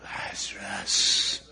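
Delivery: long, slow whisper of a name; whispered call. Retold or imitated. Preacher voicing Jesus calling the dead man, whispering.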